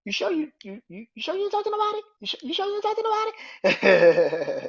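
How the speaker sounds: high, pointed mock interrogation; squeaky teasing suspicion, pushing harder; suspicious high mock voice. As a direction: needle him like you don't believe a word. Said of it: "And Sammy's like".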